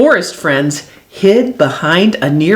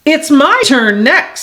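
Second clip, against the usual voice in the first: high, eager storybook voice; mildly excited, pitched up; 7 semitones higher than usual. A narrator's reading. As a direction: pipe up eagerly for your turn. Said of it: "jumping excitedly".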